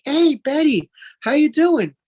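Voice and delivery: high, loud fake-cheery greeting; phony cheerful trainer. Trainer greeting client Betty, high and falsely cheerful. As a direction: put on a fake, upbeat trainer mask. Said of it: "put on that phony mask".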